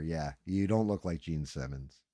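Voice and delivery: normal talking voice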